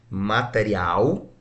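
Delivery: "material" slow, final "al" drawn out; sílaba mais forte. Shown as a demonstration. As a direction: stretch the last syllable of "material", pitch rising. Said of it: "onde é a sílaba mais forte". ("material" devagar, alongando o "al" final)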